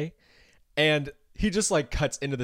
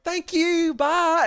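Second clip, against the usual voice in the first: very high, loud Southern sign-off; copied Southern accent; 6 dB louder, much slower than usual. Retold or imitated. Caller accidentally copying the Southern agent, exaggerated Southern accent. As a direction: overdo a borrowed Southern twang. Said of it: "accidentally saying words in a British accent".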